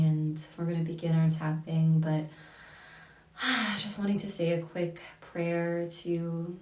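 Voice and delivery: ordinary delivery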